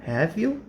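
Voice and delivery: real question tag, rising; rising tune. Shown as a demonstration. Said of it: "When the voice or intonation goes up".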